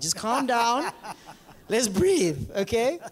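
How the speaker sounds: chuckling through it, easygoing; laughing. Real, not acted. Pastor laughing at sister's pride, chuckling. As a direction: laugh as you tell them to calm. Laughing while calming the room.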